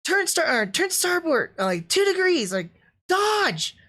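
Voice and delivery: high, urgent called-out orders; mock captain shouting maneuvers; imitating bridge orders. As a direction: bark orders at the helm. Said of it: "those battleship commands".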